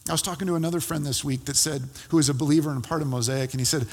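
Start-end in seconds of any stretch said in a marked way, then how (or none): none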